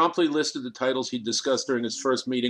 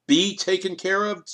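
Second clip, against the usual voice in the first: higher, insistent demand on "Be taken care of?"; 7 semitones higher, 3 dB louder than usual. A narrator's reading. Kriegel demanding answers from Sternberg, higher and insistent. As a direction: push back hard, echoing the words. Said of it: "Kriegel demanded".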